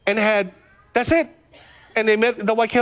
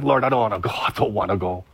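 reluctant prayer; 12 semitones lower than usual. Retold or imitated. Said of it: "I'm like".

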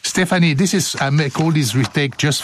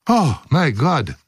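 ordinary delivery

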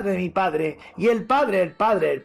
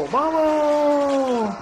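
charge cry; 9 semitones higher than usual. Acted. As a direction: urge the riders on.

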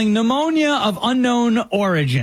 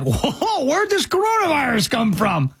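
mock surprise; 6 semitones higher than usual. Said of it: "They were like".

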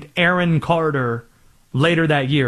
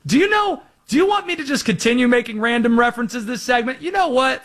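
worked-up rant; 6 semitones higher than usual. Pretend. Worked-up rant about random references.

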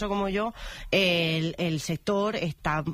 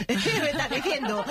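laughing disbelief, much higher; 7 semitones higher than usual. Real, not acted. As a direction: laugh through the question. Risa tras la anécdota. (riéndose, incrédula)

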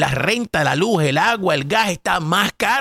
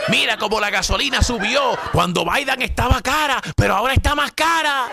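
higher, whiny hammed-up griping; mock partisan complaint; 5 semitones higher than usual. Commentator parodying voters blaming Biden. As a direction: imitate the opponents complaining. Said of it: "Eso es lo que estuvieran diciendo ustedes".

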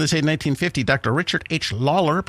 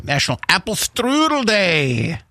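drawn-out, mock German announcement; hammed-up, badly faked German accent, slowly; 5 semitones higher, slower than usual. Radio host announcing National Apple Strudel Day, in bad German accent. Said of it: "a really bad German accent".

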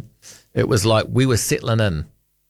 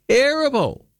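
much higher, theatrical verdict falling away; exaggerated disgust; 11 semitones higher, slower than usual. Real, not acted. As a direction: pronounce it like a dramatic sentence. Host repeats verdict on film.

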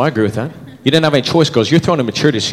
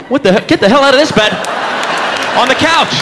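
mock outraged yell; 11 semitones higher than usual. Guy kicking girlfriend out of bed, high yell. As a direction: shout her out of bed.

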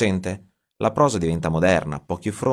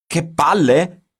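shouted, much higher and slow; outraged shout; 12 semitones higher, 8 dB louder, much slower than usual. A narrator's reading. Giorgio hurls Aldo's words back at him, outraged shout. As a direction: yell it back in disbelief. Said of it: "urlò Giorgio".

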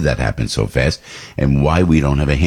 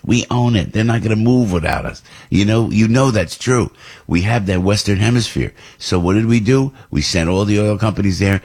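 usual speaking voice

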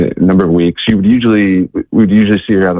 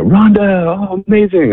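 joyful greeting; 13 semitones higher than usual. Retold or imitated. Missionaries greeting a returning friend.

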